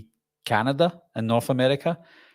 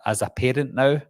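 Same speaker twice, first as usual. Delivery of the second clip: ordinary talk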